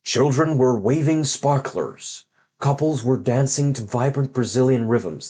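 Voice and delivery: slow, grand trailer-style narration; hammed-up epic read-aloud of a kids' book; dramatic storybook reading. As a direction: read it like an overblown movie trailer. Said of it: "You're about to hear my really good reading action".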